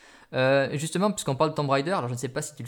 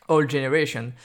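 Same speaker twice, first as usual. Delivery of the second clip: "generation" ending in an English "-shun"; amused go at an English accent, louder; attempted English accent; 7 dB louder than usual. Shown as a demonstration. Podcaster retrying old generation after self-mockery, exaggerated English accent. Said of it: "avec mon super accent anglais".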